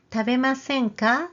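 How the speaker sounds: Tabemasen ka? with ka rising at the end; rising invitation. Shown as a demonstration. Teacher repeating the model, rising ka. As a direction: end on a lifted ka. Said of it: "So repeat after me".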